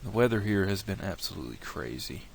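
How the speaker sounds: ordinary delivery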